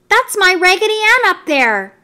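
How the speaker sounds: put-on urgent little girl. A narrator's reading. Marcella pleads.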